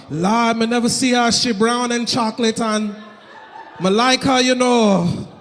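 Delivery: smitten mock Jamaican accent. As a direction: admire her in an imitated Jamaican accent. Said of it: "I see the Jamaican dude".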